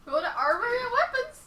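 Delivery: high, loud mock ad shout; shouted slogan. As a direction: bellow it like a cheesy store ad.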